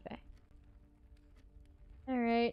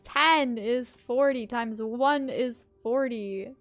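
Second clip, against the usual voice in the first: loud slow counting; 9 dB louder, much slower than usual. Pretend. Showy slow math.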